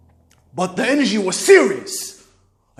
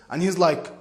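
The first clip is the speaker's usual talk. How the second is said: ordinary delivery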